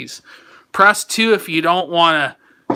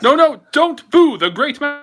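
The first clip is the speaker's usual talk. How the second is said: mock English politician accent, higher and louder; playful English politician accent, pitched up; Boris accent; 8 semitones higher, 5 dB louder than usual. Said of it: "You do the accent".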